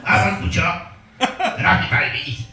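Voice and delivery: odd, demonic put-on voice; nephew's Uncle Chop impression. Seven-year-old nephew mimicking his uncle, demonic put-on voice. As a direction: do a child's mocking imitation of his uncle. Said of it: "this is an impression of".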